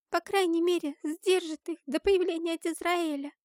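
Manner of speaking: put-on high, pressing child's voice; earnest little girl. A narrator's reading. Girl offering her amulet against the shadows, high and earnest. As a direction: explain urgently in a girl's high pitch.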